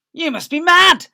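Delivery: loud high mockery; jeering villager. Villagers jeering at the farmers. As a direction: jeer it down at them.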